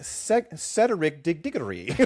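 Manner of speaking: Cedric Diggory as Ced-er-ic Dig-id-ery; mock over-syllabled Cedric Diggory; adding syllables. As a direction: stumble extra syllables into Cedric Diggory, mockingly. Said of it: "Just start, just start adding syllables everywhere".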